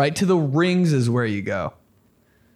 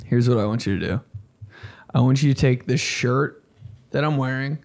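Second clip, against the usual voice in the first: ordinary delivery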